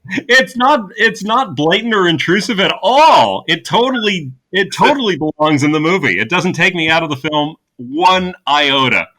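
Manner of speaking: high, loud mock-earnest sarcasm; sarcastic. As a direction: gush with heavy irony. Sarcasm about product placement.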